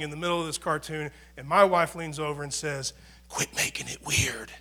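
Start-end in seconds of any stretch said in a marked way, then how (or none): 3.3-4.5 hushed whisper, barely voiced. Wife hushing her sobbing husband in the theater, whispered. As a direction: lean in and whisper it low.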